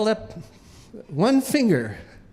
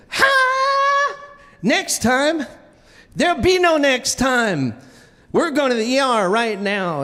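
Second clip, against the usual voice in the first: mock angry wife; 6 semitones higher than usual. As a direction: play the fed-up wife cutting him off. Wife angrily voiced in story.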